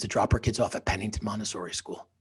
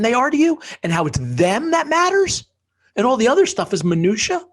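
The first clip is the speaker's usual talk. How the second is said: higher and louder, climbing; passionate; 5 semitones higher, 6 dB louder than usual. Real, not acted. Telling the host what cancer taught him, climbing fervor. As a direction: build to a peak of conviction. Impassioned lesson after cancer.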